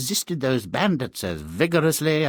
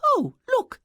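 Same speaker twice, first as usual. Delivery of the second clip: surprised. Acted. Queen spots her husband.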